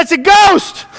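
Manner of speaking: a touch of hammed-up terror; frightened cry. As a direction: cry out as startled disciples, lightly acted. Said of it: "They cried out in fear".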